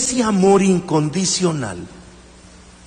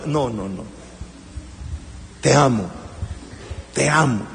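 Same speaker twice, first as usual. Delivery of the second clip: low, soft te amo; tender; 6 semitones lower, 7 dB quieter than usual. Acted. Preacher modelling love to spouse and children, tender. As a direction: speak it gently to a loved one. Models loving words to family.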